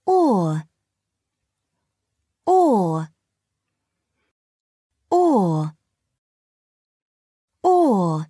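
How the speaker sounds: a long, drawn-out "or" sound, said clearly. Shown as a demonstration. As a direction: say the sound "Or" slowly on its own. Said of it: "Say the sound".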